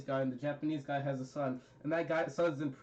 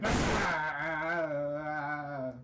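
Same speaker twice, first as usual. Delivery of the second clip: goofy cry; much slower than usual. Retold or imitated. Imitating a pretty goofy guy, goofy cry. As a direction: draw out a silly wordless wail. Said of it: "he was a pretty goofy guy".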